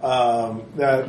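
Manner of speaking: normal talking voice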